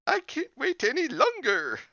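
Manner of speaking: put-on shrill high girl voice; high, loud, eager girl; impatient girl.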